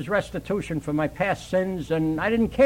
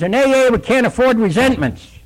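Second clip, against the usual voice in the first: chairman; 6 semitones higher, 6 dB louder than usual. Retold or imitated. Chairman voiced at meeting.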